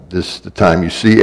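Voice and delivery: ordinary delivery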